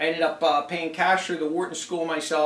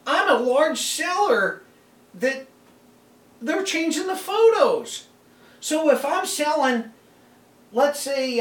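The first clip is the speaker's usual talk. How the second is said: exasperated; 6 semitones higher than usual. Real, not acted. Escalating complaint about photos.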